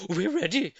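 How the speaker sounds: eager monkey chorus, a little high; monkeys answering. A narrator's reading. Monkeys answering Mugglewump, eager reply. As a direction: call out ready like eager monkeys. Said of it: "said the monkeys".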